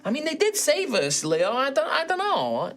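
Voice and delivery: high-pitched, hesitant creature voice; unsure satyr. Acted. Satyr reminds Leo they were saved, unsure.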